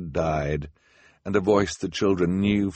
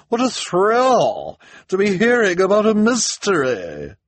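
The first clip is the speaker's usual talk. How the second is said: very high, loud gushing; over-the-top gossipy thrill; excited; 14 semitones higher, 8 dB louder than usual. A narrator's reading. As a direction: swoop through it with thrilled delight. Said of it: "Missus Morrow said excitedly".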